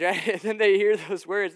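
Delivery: normal talking voice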